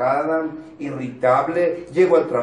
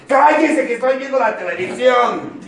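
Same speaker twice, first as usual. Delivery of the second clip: put-on high, loud furious shout at the kids; yelling over the television; angry yell; 9 semitones higher, 8 dB louder than usual. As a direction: bark it like a fed-up parent watching TV. Angry parent yelling at family.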